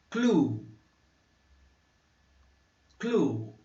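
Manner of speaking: clue with a long Portuguese-like U; English clue. Shown as a demonstration. Teacher modeling clue for students. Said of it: "é de um U semelhante".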